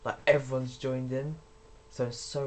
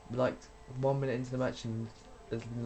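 usual speaking voice

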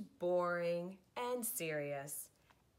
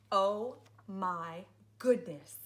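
slow and exasperated; 8 dB louder, slower than usual. Pretend. Exhausted after the nonsense.

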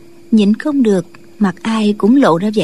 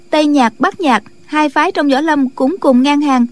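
higher-pitched, lively disciple voice; earnest disciple explaining in a raised pitch; young disciple; 4 semitones higher than usual. Acted. Disciple reasons with her new sect leader, higher-pitched.

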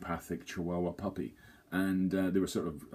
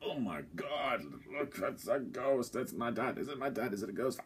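hammed-up Hamlet; 5 semitones higher than usual. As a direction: overact the shock at the ghost, a touch higher. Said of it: "He literally was acting, going".

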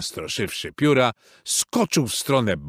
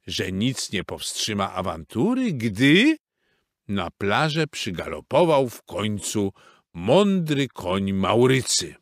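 ordinary delivery

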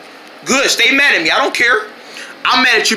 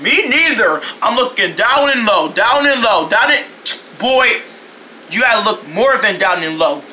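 ordinary talk, nothing marked

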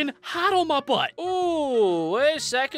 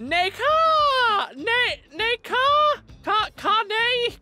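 high loud neigh and caw; mating call; 12 semitones higher, 4 dB louder, much slower than usual. Pretend. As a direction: yell out bird and horse calls. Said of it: "trying to do a mating call".

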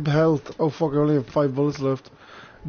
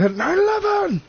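joking high yell. Acted. Streamer blurts a joke mid-laugh, comic high yell.

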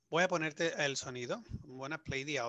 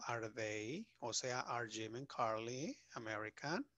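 slow teaching example; 3 semitones lower, 6 dB quieter, slower than usual. Shown as a demonstration. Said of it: "Si pregunto por mí mismo".

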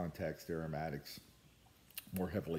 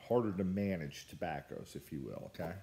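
normal talking voice

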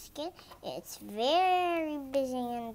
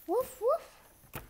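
high yappy little dog woof; puppy bark; 12 semitones higher than usual. Acted. Storybook puppy barks to its owner, high woof.

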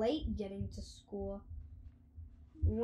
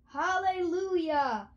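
joyful shout, higher and louder; cried out; 8 semitones higher, 9 dB louder than usual. A narrator's reading. George overjoyed at the ring arriving, shouting. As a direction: burst out in delight. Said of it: "cried George".

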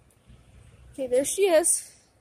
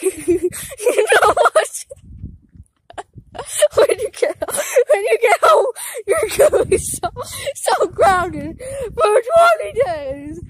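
half-laughing shouted threat; laughing scolding; 4 semitones higher, 6 dB louder than usual. Real, not acted. Kid grounding sibling while laughing. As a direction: scold through giggles.

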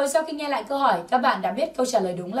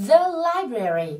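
slow, higher-pitched teaching model; đọc mẫu chậm; 5 semitones higher, much slower than usual. Shown as a demonstration. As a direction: draw it out for learners to repeat. Giáo viên đọc mẫu cho lặp lại. (đọc mẫu chậm, giọng cao hơn)

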